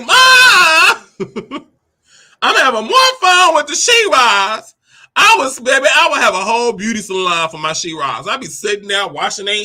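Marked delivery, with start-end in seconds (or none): 0.1-4.7 put-on higher, louder, a child calling out to Ma; excited call to mom. Child calls to his mother about She-Ras, excited shout. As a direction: call out to mom, bursting with delight.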